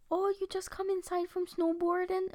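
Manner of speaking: curious locals. Retold or imitated. Locals asking bundled-up visitors at the supermarket, imitated curious question. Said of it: "people out there are like".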